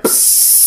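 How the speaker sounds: airy imitated spray can noise; spray can hiss. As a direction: make the sound of an aerosol spray.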